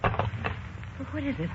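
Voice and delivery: slow breathy whisper; worried friend whispering after the call; whispered worry. Acted. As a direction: ask under the breath. Anxious friend asks softly.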